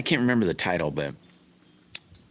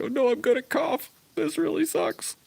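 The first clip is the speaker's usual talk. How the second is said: much higher, squeaky mock whine; whiny inner voice. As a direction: mimic yourself panicking over a cough. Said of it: "you're going".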